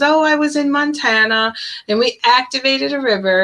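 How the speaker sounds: breezy mother proud of her ritual; mocking the mom. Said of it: "And she goes".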